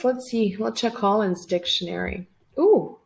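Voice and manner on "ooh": high, loud ooh of surprise; a pleased little ooh at the find; surprised ooh. Real, not acted. Reacting to the dictionary page.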